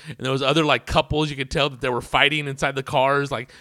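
normal talking voice

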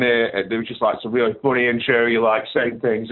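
usual speaking voice

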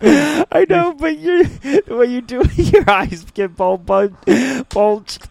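high squeal through laughter; laughing. Real, not acted. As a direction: crack up mid-sentence. Laughing mocking cohost's face.

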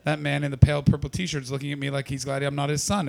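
ordinary talk, nothing marked

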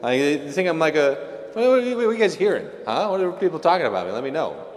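high, fast, needy voice begging for praise; squeaky eager Jesus fishing for a compliment; hammed-up insecure pitch, rushing. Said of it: "not exactly the intonation in which our Lord phrased this question".

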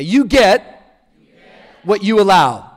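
loud call for the room to repeat. Shown as a demonstration. Preacher leading the congregation to repeat, out loud. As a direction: project it in two firm chunks. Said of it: "Say this out loud with me".

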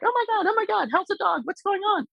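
very high, excited gushing over a dog; high squeaky doggy-greeting voice; high-pitched squeaky gushing. Retold or imitated. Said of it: "stop with the high-pitched squeaky voice".

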